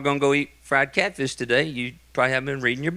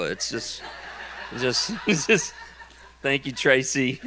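laughing; 3 semitones lower, 5 dB quieter than usual. Real, not acted. Breaks up laughing.

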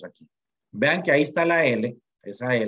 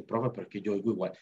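quick, softer student protest; doubting student; 3 semitones lower, 7 dB quieter, faster than usual. Pretend. Teacher mimicking a puzzled student, quick and soft. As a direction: play a student who can't hear the difference. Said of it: "Usted dice".

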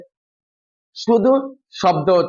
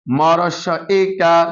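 slow spelling out; slower than usual. Shown as a demonstration. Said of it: "বানান উচ্চারণ একসাথে".

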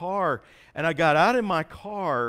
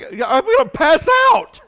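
loud, high mock-panicked shout; panicked yell; 13 semitones higher, 11 dB louder than usual. Patient having a spot burned off, yelling in panic. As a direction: cry out in hammed-up alarm.

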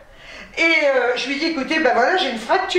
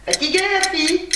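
self-mocking; 5 semitones higher than usual. Real, not acted. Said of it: "je suis fatiguée".

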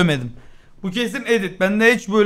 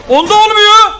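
frustrated shout; 10 dB louder than usual. Real, not acted. Frustrated gamer yelling at game.

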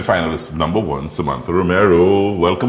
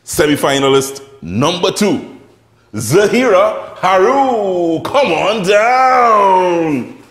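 big drawn-out game show call; booming prize announcer; 11 semitones higher, 6 dB louder, much slower than usual. Acted. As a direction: boom it out like a prize show host. Game show host calls contestant.

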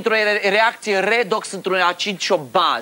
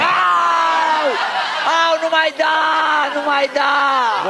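yelling in pain; 7 semitones higher, much slower than usual. Acted. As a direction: wail like a whipped child. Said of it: "ai luat și urli ca un copil".

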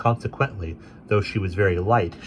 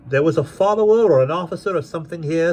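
raised polite gentleman; guardian's voice; 10 semitones higher, 6 dB louder than usual. A narrator's reading. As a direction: ask courteously, a bit higher. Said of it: "said Mister Jarndyce".

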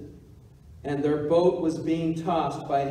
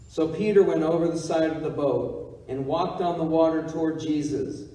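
ordinary talk

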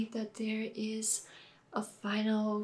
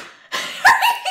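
high giddy laugh; 11 dB louder than usual. Real, not acted. Reveal of Luke.

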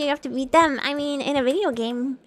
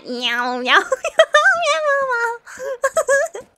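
high, drawn-out cat meows breaking into laughter; playful meowing; 10 semitones higher, much slower than usual. Pretend. Player follows a tough line with silly, laughing meows. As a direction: meow like a silly cat, giggling.

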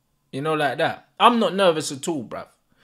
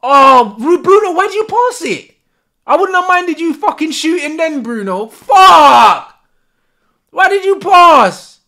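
much louder, higher angry shouting; furious yell; 9 semitones higher, 12 dB louder than usual. Real, not acted. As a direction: scream at Bruno for passing. Angry at missed shot.

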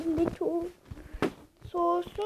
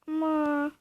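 ordinary talk

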